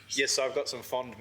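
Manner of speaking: laughing as you start the line. Real, not acted. After telling the bar story, still laughing. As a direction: let the laugh carry into the words.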